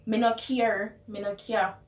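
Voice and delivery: dismissive Patois; Jamaican Patois. Shown as a demonstration. Brushing someone off, in Jamaican Patois. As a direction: shrug it off in Patois. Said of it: "how you would translate".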